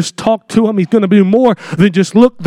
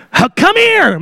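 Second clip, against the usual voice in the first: high mock call to a running kid; a hint of a harried mom; mother calling child; 10 semitones higher, slower than usual. Mom calling her toddler back, lightly voiced.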